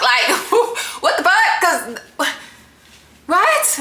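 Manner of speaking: high, baffled, through laughter; giggly, high-pitched bewilderment; laughing disbelief. Real, not acted. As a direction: laugh helplessly as you question it.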